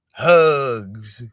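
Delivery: loud sneering single word; mocking disbelief; scornful mocking. Real, not acted. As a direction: spit out the word Hugs with scorn. Mocking family hugging killer.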